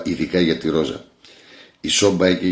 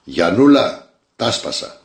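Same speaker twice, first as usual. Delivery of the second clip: loud call; 3 semitones higher, 6 dB louder, much slower than usual. A narrator's reading. Drunk husband calling his wife after smashing windows, shouting. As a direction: call out the name loud, then rising sharply in pitch. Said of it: "και φώναζε".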